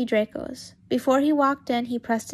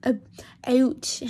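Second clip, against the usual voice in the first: ordinary delivery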